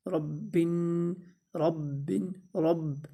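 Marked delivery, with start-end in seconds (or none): 0.1-1.1 ربن said very slowly, with the doubled ب pressed hard; firm doubled ba. Shown as a demonstration. Teacher showing students the firm doubled letter in ربن. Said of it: "اس کو سختی سے پڑھیں گے".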